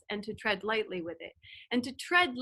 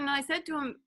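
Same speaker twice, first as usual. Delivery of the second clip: normal talking voice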